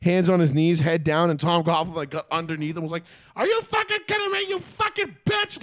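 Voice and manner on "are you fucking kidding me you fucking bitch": much higher, mock screaming coach; imitated coach screaming. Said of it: "screaming at him".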